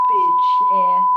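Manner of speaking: sudden high yell; shouted insult. Acted. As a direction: spit the insult out loud. Scammers gloat, yelling insult.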